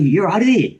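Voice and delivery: high-pitched attempt at Australian; bad Australian accent. Imitating the girl at the hostel, attempted Australian accent. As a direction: have a shaky go at an Australian accent. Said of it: "That was Australian, by the way".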